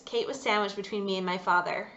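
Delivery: usual speaking voice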